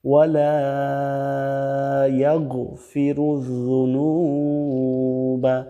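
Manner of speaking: slow, drawn-out Arabic chant; slow Quranic recitation. Acted. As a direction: recite the dua with long held vowels. Continues slow prayer recitation.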